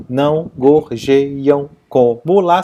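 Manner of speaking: slow, separated syllables on "Não gorjeiam como lá". Shown as a demonstration. Teacher splitting the verse, "Não gorjeiam como lá" syllable by syllable. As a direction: give each syllable of "Não gorjeiam como lá" its own beat. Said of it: "Separando em sílabas gramaticais".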